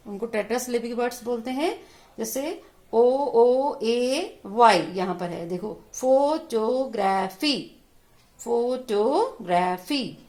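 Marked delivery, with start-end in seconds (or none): 5.9-10.2 "photography" slow, in four separate beats; stretched teacher's model of "photography"; syllable-by-syllable demo. Shown as a demonstration. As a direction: break "photography" into फो, टो, ग्रा, फी. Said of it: "अलग-अलग करके पढ़ेंगे".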